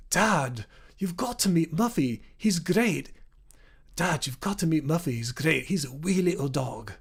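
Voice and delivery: put-on Scottish child from Glasgow calling to dad; Glaswegian accent. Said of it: "that's my Glaswegian accent there".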